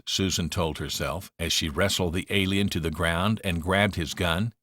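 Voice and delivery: ordinary delivery, nothing marked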